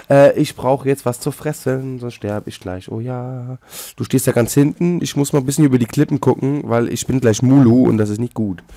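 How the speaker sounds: usual speaking voice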